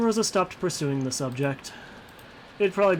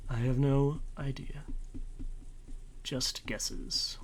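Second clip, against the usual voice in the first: whispering; 7 dB quieter, slower than usual. Real, not acted. Aside while guessing plot.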